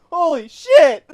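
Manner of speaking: high, loud shocked yelp; shocked shout. Real, not acted. Shocked by the smelling salts.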